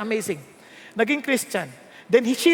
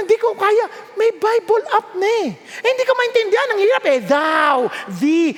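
a pastor mocking excuses for not reading, whiny; mock complainer; 7 semitones higher than usual. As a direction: whine like a lazy Bible reader. Mimicking excuse-making believers.

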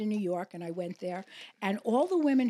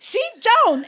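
loud, high imitated command; mock stern order; 12 semitones higher, 11 dB louder than usual. Facialist stops client escaping, mock shouted order. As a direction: bellow it like a bossy facialist.

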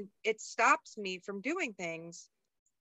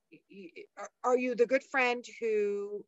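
ordinary talk

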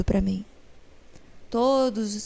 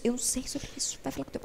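whispered, much softer retold aside; hushed confession; 4 semitones higher, 10 dB quieter, faster than usual. Mother passing daughter's question to dad, whispered aside. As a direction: drop into a whisper, as if confiding.

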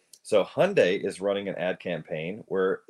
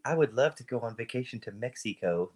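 affected, mock-haughty traveller's talk; haughty mother imitation; 3 semitones higher than usual. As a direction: put on airs as your mother would. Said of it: "It sounds haughty".

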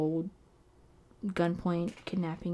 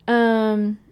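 nervous laugh; 3 semitones higher, 10 dB louder than usual. Real, not acted. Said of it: "All this laughing is nervous laughter".

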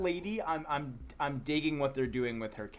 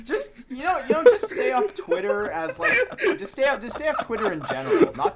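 laughing; 11 semitones higher, 8 dB louder than usual. Real, not acted. Laughing while scolding.

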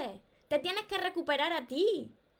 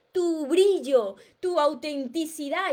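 very slow, louder, each word weighed; slow emphatic list; 2 semitones higher, 5 dB louder, slower than usual. Real, not acted. Coach to viewers naming what to reclaim, slow and louder. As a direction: savour each word as a gift.

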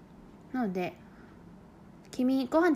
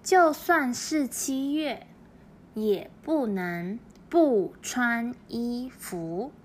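就算是七月 read very slowly, syllable by syllable; very slow model sentence; much slower than usual. Shown as a demonstration. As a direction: say the Chinese sentence slowly so learners catch each syllable. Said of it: "中国語を言います". (とてもゆっくりした中国語の模範読み)

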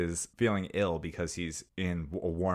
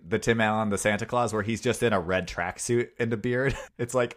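ordinary delivery

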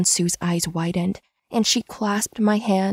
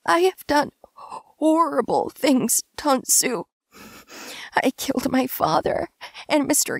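anguished confession; 8 semitones higher than usual. A narrator's reading. Wounded Kim confessing his crimes to Tansu, pained. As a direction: choke out regret.

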